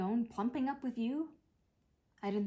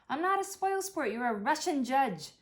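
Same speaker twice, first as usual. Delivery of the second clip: indignant; 5 semitones higher, 5 dB louder than usual. Real, not acted. Teasing protest.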